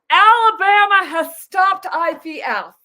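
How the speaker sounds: high, loud mock alarm; mocking the administration. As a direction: squeal it like fake panic. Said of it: "Just totally lying".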